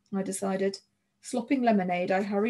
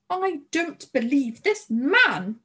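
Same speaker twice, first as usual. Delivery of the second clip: exasperated outburst, drawn out; high, loud, slow exasperation; disgusted cry; 5 semitones higher, 5 dB louder, much slower than usual. A narrator's reading. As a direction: cry out slowly in indignant disbelief. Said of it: "Missus Mendoza cried, shaking the telegram in disgust".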